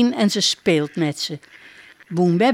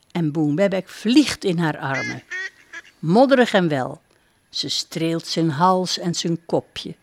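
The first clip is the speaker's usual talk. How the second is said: usual speaking voice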